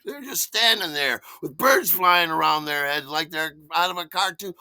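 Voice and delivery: ordinary talk, nothing marked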